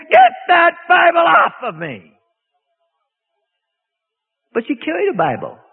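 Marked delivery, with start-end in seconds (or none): 0.1-2.1 loud, slow demonic shout; screamed demon line. Retold or imitated. Preacher retelling the demon's scream at the Bible, loud shout. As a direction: bark it like a possessed woman. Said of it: "and she screamed".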